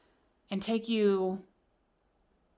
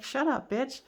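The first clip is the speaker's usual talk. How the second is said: mock snippy retort, pitched up; annoyed viewer; 5 semitones higher than usual. Reader voices the annoyed viewer telling her to stop, mock snippy. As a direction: play the fed-up client snapping back.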